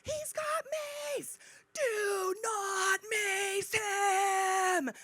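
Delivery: high, drawn-out warning scream; full-lung scream. Retold or imitated. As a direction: stretch each word into a long, high yell. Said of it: "I scream at the top of my lungs".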